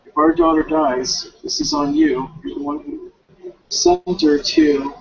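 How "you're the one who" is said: usual speaking voice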